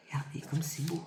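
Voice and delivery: soft unvoiced whisper. Real, not acted. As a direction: whisper it to yourself.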